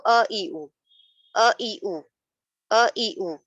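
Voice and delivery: ا، ای، او short and even, without jerk; brief smooth "uh, i, u" for ا، ای، او; correct short vowels. Shown as a demonstration. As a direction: model ا، ای، او neither stretched nor jolted. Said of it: "کیسے پڑھیں گے اس کو؟".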